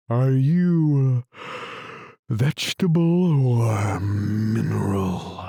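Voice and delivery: yawning. A narrator's reading. Weary lion questioning Alice, yawning at every other word. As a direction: yawn hugely as you talk. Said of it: "yawning at every other word".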